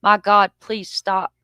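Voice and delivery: read-out scream, loud at first, then easing off; mock cry, punched loud on the opening words; mild retold scream. A narrator's reading. Victim begging attackers to stop, mildly acted scream. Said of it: "she screams".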